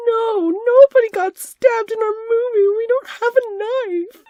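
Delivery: high, slow, tearful panic; high crying protest; panicked denial. Acted. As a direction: plead it, near tears and high.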